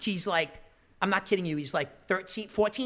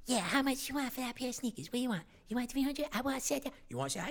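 quick, softer hustler chatter in a put-on voice; haggling kids; 5 semitones higher, 7 dB quieter, faster than usual. Kid sneaker dealers haggling with buyers, quick and hushed. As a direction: rattle off a street haggle. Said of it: "this is what you hear".

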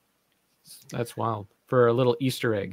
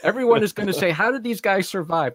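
lightly imitated exasperated director, pitched up; higher, animated mock complaint; Ivan Reitman; 7 semitones higher, 4 dB louder than usual. Director objecting to an effects shot, mildly hammed-up exasperation. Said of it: "Even Ivan Reitman said".

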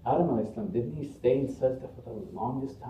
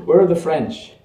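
higher, loud mock-British call; British comic host calling out; imitated British accent; 6 semitones higher, 11 dB louder than usual. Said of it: "he has his British accent".

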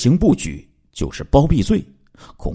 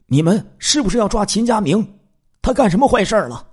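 very high-pitched, anxious-to-know questions; high, hurried acted inquiry; urgent questioning; 13 semitones higher than usual. A narrator's reading. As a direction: ask urgently, voice shooting up, speeding up at the end. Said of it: "刘俊义赶忙问".

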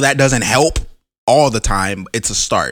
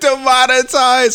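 slow, high, loud comic echo; playful shouted echo; mock wail; 13 semitones higher, 4 dB louder, slower than usual. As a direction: stretch the word out in a high yell. Echoing joke.

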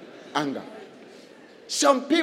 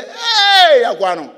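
higher and louder, a jeering hey; mock scoffing call; 8 semitones higher, 10 dB louder, slower than usual. Preacher imitates people scoffing at ambition, jeering. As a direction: jeer at the dreamer.

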